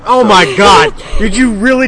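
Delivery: oh my God, caught off guard; shocked outburst. Real, not acted.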